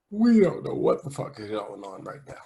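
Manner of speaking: talking through a yawn. Real, not acted. Podcaster caught mid-sentence by tiredness, yawning. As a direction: let a real yawn stretch the middle of the words. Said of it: "I yawned in the middle of that".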